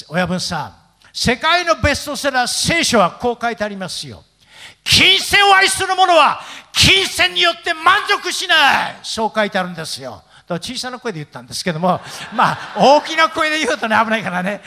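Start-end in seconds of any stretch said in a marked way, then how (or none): 4.8-9.0 high, slow shouted Bible verse; loud proclamation. Retold or imitated. Pastor quotes scripture to yakuza boss, loud proclamation. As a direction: declaim it loudly, stretching every word.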